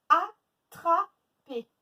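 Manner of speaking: ATTRAPER as loud a, tra, per with pauses; split into syllables. Shown as a demonstration. Teacher giving pupils a new word, syllable by syllable. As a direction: break Attraper into three slow beats. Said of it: "Trois syllabes".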